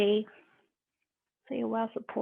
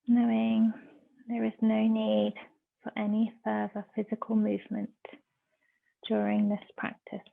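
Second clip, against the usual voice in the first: measured meditation voice with long pauses; guided meditation. Real, not acted. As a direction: guide them into stillness, phrase by phrase.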